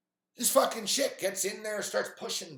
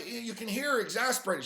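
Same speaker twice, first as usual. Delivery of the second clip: ordinary delivery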